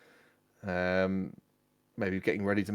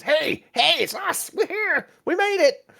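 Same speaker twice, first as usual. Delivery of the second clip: very high, loud, jubilant hail; overjoyed high-pitched yelling; excited shouting; 7 dB louder than usual. Acted. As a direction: call out excitedly to friends across a crowd. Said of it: "waving his arms and jumping up and down".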